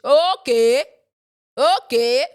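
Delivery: loud okay, sharply rising; loud, questioning disbelief; pointed sarcastic okay. Real, not acted. Vlogger reacting to his odd capitals, loud incredulous okay.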